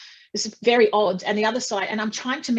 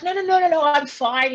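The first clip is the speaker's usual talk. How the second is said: insistent; 8 semitones higher than usual. Retold or imitated. Insisting to paramedic.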